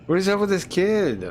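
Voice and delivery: high, loud disbelieving outburst; freaked out. Real, not acted. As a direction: shout it in shocked disbelief. Repeats the shocked question.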